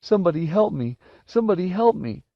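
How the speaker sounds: high-pitched panicked cry for help; tearful terrified boy; sobbing. Acted. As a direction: wail for help while fleeing. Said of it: "Wendell was sobbing as he ran".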